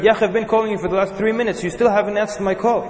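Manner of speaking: mock the annoyed caller's nagging; angry caller. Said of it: "Third message".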